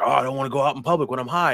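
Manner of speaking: hurried mock fretting; mock annoyed whining. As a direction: mimic someone anxiously complaining. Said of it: "he gets real annoyed out".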